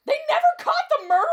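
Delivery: high, loud, rapid shriek; panicked question. Acted. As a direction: blurt it out in terror. Panics hearing killer is free.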